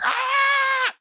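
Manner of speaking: sudden high yell of fake rage; a brief hammed-up shriek of anger; mock furious shout. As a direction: let out a short fake rage scream. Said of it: "I'm just like".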